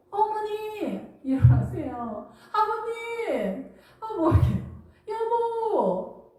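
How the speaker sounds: high, drawn-out singsong calls; hammed-up dutiful wife calling the household slowly; sweet, obliging daughter-in-law. Daughter-in-law wakes in-laws and husband for breakfast, hammed-up sweet calling. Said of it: "싹싹한 며느리 좀 돼봐라".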